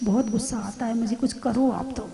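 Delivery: soft, plaintive plea from a woman who gets angry; hushed, pleading request to the guru; complaining woman. Retold or imitated. Woman begs mata ji for help with her anger, softly pleading. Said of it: "बहुत महिलाएं आती बोले".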